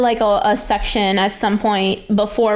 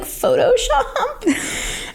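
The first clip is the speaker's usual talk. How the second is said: high, slow, through a laugh; drawn out, giggling as he guesses; a Photoshop guess dissolving into laughter; laughing question; 12 semitones higher, much slower than usual. Retold or imitated. Ricky voiced guessing, laughing.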